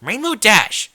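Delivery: high, loud angry shout of her name; Rarity scolding Rainbow in a loud, high angry shout; lashing out. A narrator's reading. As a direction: bark the name like a whip. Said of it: "Her words lashed out".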